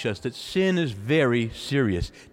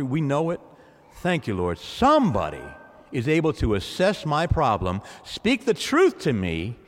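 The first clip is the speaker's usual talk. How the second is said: ordinary talk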